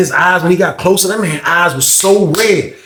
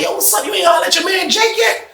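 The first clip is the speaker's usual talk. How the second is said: high, softer retold nagging; pestering buyer; 3 dB quieter than usual. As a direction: imitate someone pestering, pitched way up. Buyer presses him about Jake.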